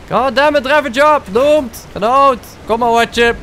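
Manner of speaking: high, loud real rage at the game; furious shout. Real, not acted. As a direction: yell in real anger mid-fight. Fighting, pet losing.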